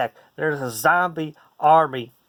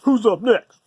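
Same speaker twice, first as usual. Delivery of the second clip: higher and louder, brash; cocky zombie greeting; 7 semitones higher, 7 dB louder, slower than usual. Retold or imitated. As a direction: swagger in like a lone challenger. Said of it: "I just came and said".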